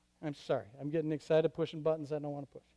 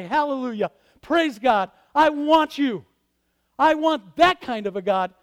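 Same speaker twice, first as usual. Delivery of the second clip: loud, rising hallelujah; joyful praise; 5 semitones higher, 9 dB louder than usual. Acted. Preacher shouting praise to the God he wants. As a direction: lift it up like worship.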